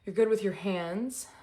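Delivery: usual speaking voice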